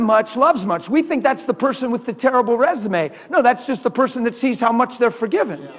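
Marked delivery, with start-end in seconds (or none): none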